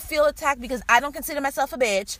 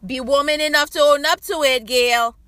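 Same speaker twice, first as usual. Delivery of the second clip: raised, louder, emphatic challenge; loud call-out; 3 semitones higher, 7 dB louder than usual. Real, not acted. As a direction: call her out by name, loud and insistent. Calls out Gail, then repeats it plainly.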